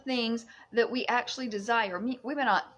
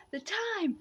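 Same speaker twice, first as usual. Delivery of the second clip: high, slow whisper; mystic whisper; 12 semitones higher, much slower than usual. Pretend. Whispering a mystic truth to viewers. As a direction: breathe it out slow and secret.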